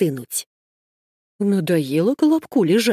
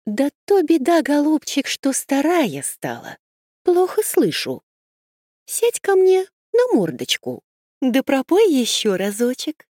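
higher, sweet-talking fox; sly fox voice; 6 semitones higher than usual. Acted. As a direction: coax him like a cunning fox. The fox speaks as old.

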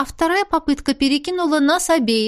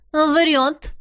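firm, higher-pitched retort; decisive; 5 semitones higher than usual. A narrator's reading. Cat denies the gossip about the rector, decisively. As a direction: cut in decisively with a denial. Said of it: "решительно ответила кошка".

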